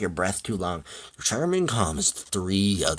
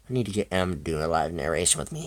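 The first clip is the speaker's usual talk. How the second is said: ordinary delivery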